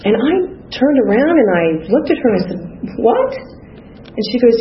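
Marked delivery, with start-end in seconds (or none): 3.0-3.3 sharp high disbelieving what, pitch jumping way up; shocked what. Retold or imitated. Hearing she is like a daughter, stunned high what. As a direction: blurt it out as if you can't believe your ears. Stunned reaction to sponsor's words.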